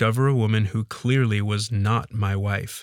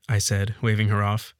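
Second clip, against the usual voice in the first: usual speaking voice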